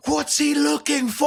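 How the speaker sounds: high, loud yell from the back of the crowd; fan shouting from the crowd. Retold or imitated. Retelling a London fan yelling in the crowd, shouted high. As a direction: bellow it like a fan in the stands. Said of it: "a London fan in the background say".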